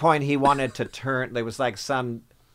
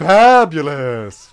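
slow, loud drawn-out "Fabulous"; campy fashion-crowd praise; mock fabulous; 3 semitones higher, 12 dB louder, much slower than usual. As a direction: stretch it like a gushing fashionista. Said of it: "We're like".

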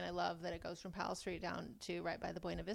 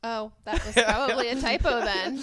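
louder, laughing through it; 4 semitones higher, 13 dB louder than usual. Real, not acted. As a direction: laugh off being caught out. Caught out, laughing it off.

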